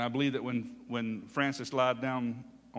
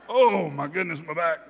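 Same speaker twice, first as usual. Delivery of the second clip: louder, hammed-up backache complaint; mock aching groan; 6 dB louder than usual. Preacher mimics old folks getting up, achy groan. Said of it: "didn't make any sound effects".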